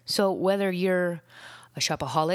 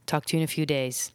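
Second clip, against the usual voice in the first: ordinary delivery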